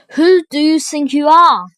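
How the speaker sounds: loud, indignant challenge; furious demand. A narrator's reading. Prince confronting the soldier, loud furious challenge. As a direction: storm at him. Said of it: "storming up to him".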